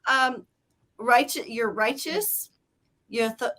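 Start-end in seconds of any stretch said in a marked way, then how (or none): none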